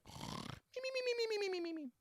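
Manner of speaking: sped-up squeaky chipmunk babble; high Alvin-style mimimi gibber. Retold or imitated. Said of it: "but in the [name] voice".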